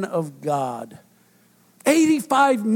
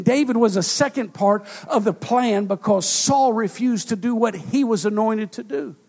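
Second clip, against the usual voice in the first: usual speaking voice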